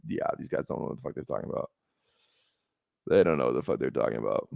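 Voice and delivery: low, whispered contempt; scornful mutter. Real, not acted. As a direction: sneer at the rankings under his breath. Scoffs at a rankings list.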